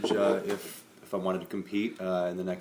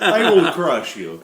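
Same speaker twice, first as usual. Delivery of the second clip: put-on Russian accent; 7 semitones higher, 11 dB louder, slower than usual. Mock Russian fighter threat.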